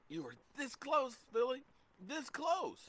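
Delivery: high mocking sing-song "this close"; teasing. Teasing Eagles fan Philly, high and mocking.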